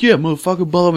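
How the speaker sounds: high-pitched mock rant; imitated screaming. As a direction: rattle off a stranger's tirade. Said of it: "He started screaming some shit".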